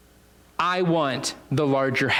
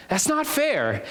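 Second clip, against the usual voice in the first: whiny complaint; 8 semitones higher than usual. Acted. Grumbling workers voiced.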